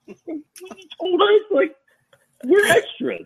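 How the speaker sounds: high, slow confused protest; mock confused extras; baffled extras. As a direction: play it totally bewildered. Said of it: "these people all look at each other like".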